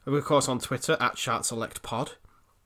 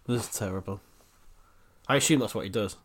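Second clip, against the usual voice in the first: ordinary delivery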